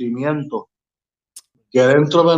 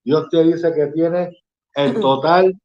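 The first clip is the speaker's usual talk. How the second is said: worship praise; 2 semitones lower than usual. Acted. Preacher leading the studio in praise, fervent. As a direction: proclaim it with fervent faith.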